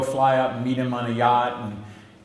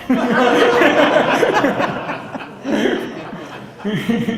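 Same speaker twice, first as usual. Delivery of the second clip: long hearty laugh; 11 semitones higher, 6 dB louder than usual. Real, not acted. Laughs at the surprise visit.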